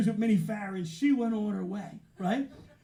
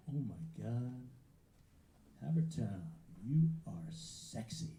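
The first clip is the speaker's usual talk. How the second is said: hushed flirtation; 11 semitones lower, 10 dB quieter than usual. Retold or imitated. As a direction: drop low and savour it, flirting with the crowd. Retells his awed flirting with crowd.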